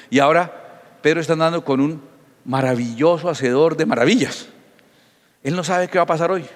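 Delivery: ordinary talk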